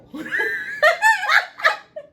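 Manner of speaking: long, high, loud laugh. Real, not acted. Mother cracking up over son's fists, laughing. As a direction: burst out laughing mid-story.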